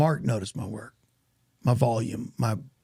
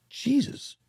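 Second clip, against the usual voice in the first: high-pitched, impressed exclamation; impressed disbelief; 10 semitones higher than usual. Retold or imitated. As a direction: imitate a director taken aback. Acting out director reading the resume.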